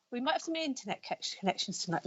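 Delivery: talking with loose false teeth; words slurred round loose dentures. Real, not acted. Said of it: "Ooh, put my teeth back in".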